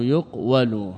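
"يقول" as yuq-walu, slow and split; underlying-form pronunciation. Shown as a demonstration. Teacher deriving yuqal from its origin, "يقول" slowly as yuq-walu. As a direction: sound a full w after a closed yuq in "يقول". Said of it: "يقال أصل يقول".

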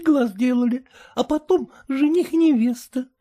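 put-on high, cheeky girl's voice; high mocking daughter; pert young daughter. A narrator's reading. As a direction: tease the mother lightly.

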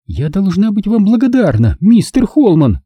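grind out the thanks with contempt; through gritted teeth. A narrator's reading. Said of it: "процедила Гурия сквозь зубы".

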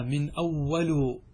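"أول" ending in a short u; damma on the lam. Shown as a demonstration. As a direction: say "أول" as awwalu. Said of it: "بضم اللام وفتحها وكسرها".

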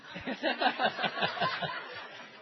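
long, breathless laugh trailing off; real laughter. Real, not acted. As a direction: crack up at your own joke. Laughs at audience reaction.

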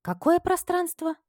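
high, slow puzzled question; genuinely puzzled young maid; baffled. A narrator's reading.

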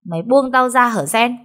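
high, loud scolding bark; exasperated snap. Acted. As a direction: snap at the clingy maid to let go. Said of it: "cậu quát nó".

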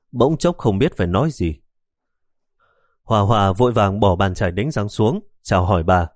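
ordinary delivery

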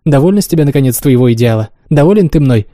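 ordinary delivery, nothing marked

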